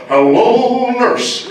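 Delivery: high loud cartoon voice; Wacko voice. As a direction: belt it out like a crazy cartoon. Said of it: "I'm like".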